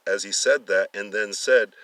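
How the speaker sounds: plain reading voice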